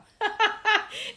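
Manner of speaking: high, loud burst of laughter. Real, not acted. Laughing at her own confession.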